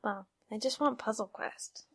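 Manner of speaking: whispered under the breath; whispering. Real, not acted. Wistful aside about the game.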